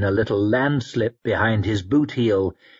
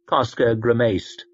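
usual speaking voice

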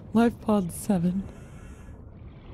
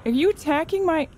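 alarmed yelp; 12 semitones higher than usual. Real, not acted. Diver shouting at a creature attacking her Seamoth, high. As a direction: cry out as it attacks.

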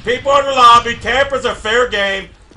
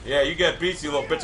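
ordinary delivery

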